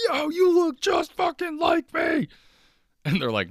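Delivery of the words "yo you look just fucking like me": hammed-up high, loud, drawn-out shriek; losing your mind. Person meeting their doppelganger, losing their mind. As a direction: shout in falsetto, utterly amazed. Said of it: "you're gonna lose your mind".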